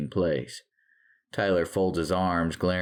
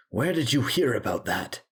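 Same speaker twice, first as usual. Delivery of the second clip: higher, insistent questioning; demanding; 5 semitones higher than usual. A narrator's reading. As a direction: demand an answer from her.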